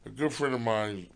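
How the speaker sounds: ordinary talk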